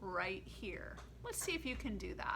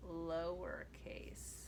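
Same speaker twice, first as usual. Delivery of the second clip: slow whisper for lowercase; 5 semitones lower, 6 dB quieter, much slower than usual. Shown as a demonstration. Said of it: "uppercase and lowercase chant".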